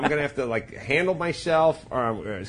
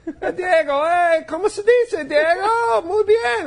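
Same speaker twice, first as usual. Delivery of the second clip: very high, loud squeaky cartoon-kid voice; mock cartoon Spanish; 7 dB louder, slower than usual. Dad mocking the Spanish cartoon his twins watch, squeaky high. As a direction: ham up the chirpy Spanish cartoon host. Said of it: "watch them in fucking Spanish".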